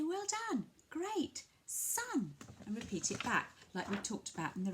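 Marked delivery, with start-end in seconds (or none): 0.0-2.3 high, bright praise; praising a toddler. Shown as a demonstration. Parent praising a toddler's first word, high and bright. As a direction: cheer the child on up high.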